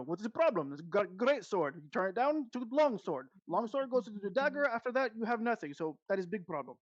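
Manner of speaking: put-on hard-sell it in a German-Russian merchant accent; sleazy shopkeep accent. DM showing his friend a German-Russian accent for a sleazy shopkeep. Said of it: "this really sleazy shopkeep".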